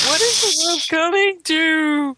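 loud, high theatrical dismay; mock outrage. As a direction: wail it like a scandalized aunt.

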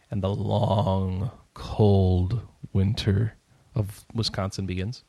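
drawn out, low and slow; slow and ominous. Acted. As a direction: stretch it like a doom prophecy. Dramatizing winter.

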